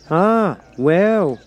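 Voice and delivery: Duchess. A narrator's reading. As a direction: brush the point aside. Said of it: "said the Duchess".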